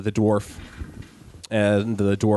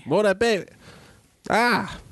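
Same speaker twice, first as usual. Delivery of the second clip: put-on elderly woman voice; 10 semitones higher, slower than usual. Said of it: "my elderly voice isn't very good".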